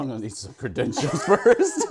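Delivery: mock-serious line breaking into laughter; joking retort. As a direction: tease him, laughing through the words. Joking reply to trainer story.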